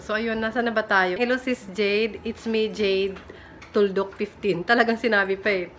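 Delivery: ordinary delivery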